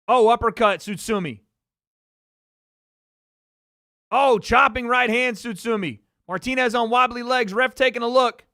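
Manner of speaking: loud, high live fight call; wrestling commentator. Real, not acted. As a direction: call every punch at full volume. Live boxing play-by-play.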